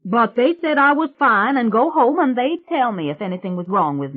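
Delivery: put-on loud, high, brash country girl; a loud, high swamp witch bragging to the family. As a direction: belt it out like a cocky backwoods girl. [name]'s rowdy swamp girl voice.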